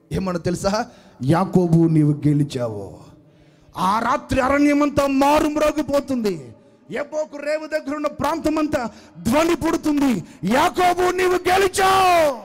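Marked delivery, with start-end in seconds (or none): 1.2-3.0 low loud heavenly voice. Acted. Heavenly voice announces. 10.4-12.4 high booming echo. Acted. Echoing across the wilderness.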